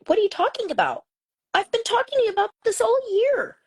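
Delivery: high, insistent voice of disbelief; incredulous teacher. Retold or imitated. Teacher texting a parent about her son, incredulous. Teacher's retold exasperated reply.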